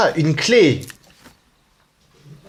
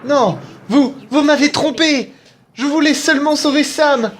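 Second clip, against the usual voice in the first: distraught plea; 11 semitones higher than usual. Acted. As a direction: cry out that she was tricked. Dubbing a betrayed wife.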